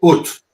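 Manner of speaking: "Ut." with an oo vowel, cut short on the t; monotonal pronunciation. Shown as a demonstration. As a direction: say "Ut." as a short rounded "oot" with a hard t. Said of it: "how do you say it in monotone again".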